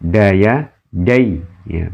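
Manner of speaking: "dai" short, its vowel not stretched; lin reading demo. Shown as a demonstration. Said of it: "bukan dai, tapi dai ya".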